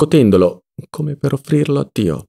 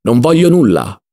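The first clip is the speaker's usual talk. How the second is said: mildly raised, defiant cry; angry shout; 2 semitones higher, 4 dB louder than usual. A narrator's reading. Ziddima refusing Don Lollò's money, angry shout. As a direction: refuse it crossly. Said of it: "gridò Ziddima".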